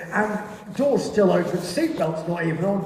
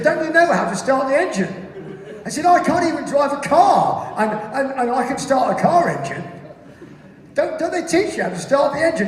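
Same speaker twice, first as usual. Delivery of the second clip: high, loud incredulous questioning; acted exasperated passenger arguing back; 7 semitones higher, 4 dB louder than usual. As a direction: protest in raised, higher pitch.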